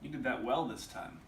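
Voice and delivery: "well" jumps up in pitch; stress on "well". Shown as a demonstration. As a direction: put the weight on "well". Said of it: "different inflection on the words".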